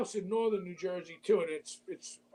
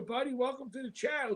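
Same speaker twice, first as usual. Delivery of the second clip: ordinary talk, nothing marked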